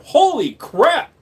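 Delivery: high, loud, stretched-out exclamation; amazed outburst. Real, not acted. Streamer reacting to the live stream, amazed outburst. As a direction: burst out in slow-motion astonishment.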